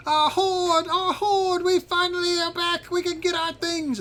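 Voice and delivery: very high squeaky creature voice; excited cheering creatures. Pretend. Little creatures running in to their hoard, high excited cheering. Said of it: "they start cheering and being very excited".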